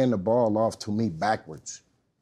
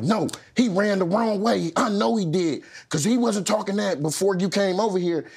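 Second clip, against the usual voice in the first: higher, louder protesting coach; arguing coach; 10 semitones higher, 5 dB louder than usual. Retold or imitated. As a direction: insist hard, pleading your case.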